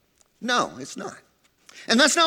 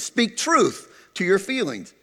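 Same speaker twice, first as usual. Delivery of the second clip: usual speaking voice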